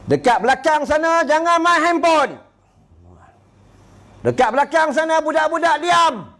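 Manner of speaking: high, stern scolding from the pulpit; imitating a fierce preacher. Fierce ustaz scolding the congregation from the pulpit, high stern voice. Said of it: "dia pun garang".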